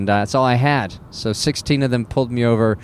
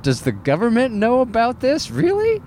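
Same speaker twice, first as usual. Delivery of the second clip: much higher, mock-outraged question; outraged disbelief; 10 semitones higher than usual. Retelling the baggage fee to the audience, high mock outrage. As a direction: pitch it way up in comic indignation. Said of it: "I'm like".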